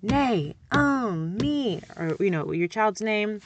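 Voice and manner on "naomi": Naomi split into slow beats; clapped syllables. Shown as a demonstration. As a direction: beat Naomi out like a drum. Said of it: "beat on the table".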